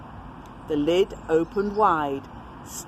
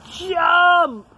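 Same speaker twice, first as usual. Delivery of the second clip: high, loud barked command; pirates yell; 11 semitones higher, 7 dB louder than usual. Acted. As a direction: yell it at the troll. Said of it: "yelled the pirates".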